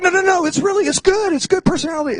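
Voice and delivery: high mock-nervous insistence; flustered defending. Defending the friend, acted quote.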